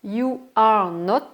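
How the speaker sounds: carefully articulated classroom demo; slow model reading. Shown as a demonstration. Teacher showing the full negative form, slow and loud. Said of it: "teacher modelling English forms".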